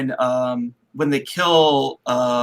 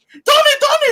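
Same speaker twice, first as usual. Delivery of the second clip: high, loud repeated shout; mock urgent calling; 6 dB louder than usual. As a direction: call through a door in acted alarm. Acting dude banging on the door.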